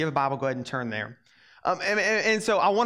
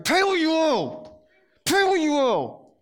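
higher, louder, slow shouted demand; 10 semitones higher, 4 dB louder, slower than usual. Shown as a demonstration. Servant demanding his debt back, slow high shout. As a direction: bark it out like a creditor choking his debtor. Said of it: "everybody like this and go".

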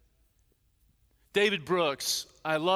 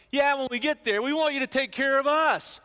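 higher, louder mock of the naive disciples; clueless disciples; 7 semitones higher, 5 dB louder than usual. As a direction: play them as eager, oblivious favor-seekers. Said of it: "the disciples are so clueless".

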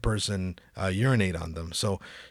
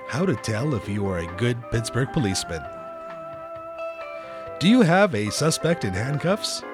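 instructional announcer. Acted. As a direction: read it like a training video. Reads mock tutorial over music.

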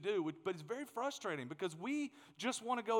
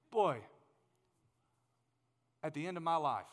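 ordinary talk, nothing marked

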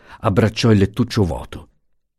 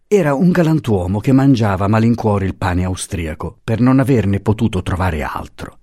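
ordinary delivery

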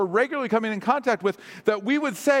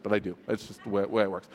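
usual speaking voice

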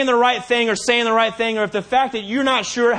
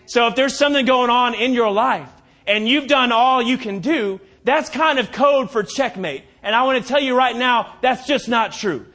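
rising preacher's climax, louder and driving; preaching; 5 semitones higher, 4 dB louder than usual. Acted.

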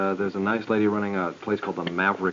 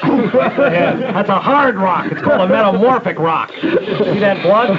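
high, loud, slow laughing teacher voice; mock teacher; 10 semitones higher, 9 dB louder, much slower than usual. Mocking the fifth-grade geology teacher, in a loud laughing voice. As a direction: ham up the teacher through laughter.

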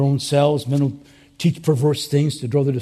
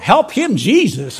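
mock church holler, high and loud; 5 semitones higher, 5 dB louder than usual. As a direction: call out like a congregant urging on the preacher. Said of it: "they just holler out".

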